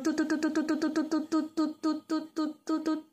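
quick soft toot toot sounds; little horn noises, soft and quick. Acted. Teacher makes horn sound.

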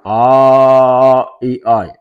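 "O, i, oi." tách từng âm, to và kéo dài; đánh vần mẫu chậm "O, i, oi."; spelled out sounds. Shown as a demonstration. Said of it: "Thì bạn đọc là oi". (đánh vần chậm "O, i, oi." rõ từng âm)

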